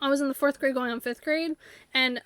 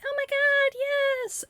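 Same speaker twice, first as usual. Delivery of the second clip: much higher, thrilled squeal; ecstatic squeal; 13 semitones higher, slower than usual. Pretend. Escaping object celebrating mid-air, squealing high with joy. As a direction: squeal like you just won your freedom.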